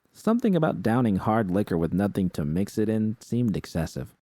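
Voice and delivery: ordinary delivery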